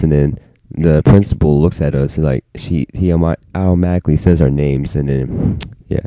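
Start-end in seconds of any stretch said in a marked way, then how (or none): none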